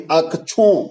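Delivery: अक्षों said "ak-chhon"; childhood mispronunciation. Retold or imitated. Teacher quoting what children are taught, अक्षों as "akchhon". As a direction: chhh in place of sh in अक्षों. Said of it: "बोलने के लिए सिखाया जाता है".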